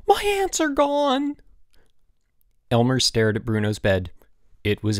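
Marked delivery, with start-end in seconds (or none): 0.1-1.4 high, drawn-out wail of alarm; panicked cry. A narrator's reading. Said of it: "he cried suddenly".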